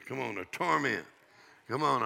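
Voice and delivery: normal talking voice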